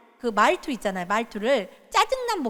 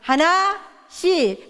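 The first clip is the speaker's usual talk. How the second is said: drawn-out, very slow and higher; 6 semitones higher, 4 dB louder, much slower than usual. Shown as a demonstration. A teacher drilling the class very slowly. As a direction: stretch each syllable. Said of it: "하나씩입니다".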